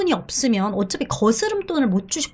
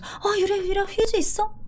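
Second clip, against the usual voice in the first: urgent ask; 8 semitones higher, 6 dB quieter than usual. Pretend. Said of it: "이거 엄청 급하죠".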